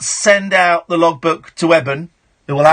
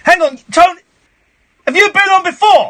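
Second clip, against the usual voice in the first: high, raised-voice interrogation; indignant radio host grilling a caller; exasperated challenge; 11 semitones higher, 4 dB louder than usual. Real, not acted. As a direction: cut in, demanding an answer.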